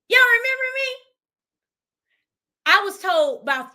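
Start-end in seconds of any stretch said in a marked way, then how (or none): 0.1-1.0 higher and louder "Y'all remember me?"; delighted surprise. Real, not acted. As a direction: marvel out loud.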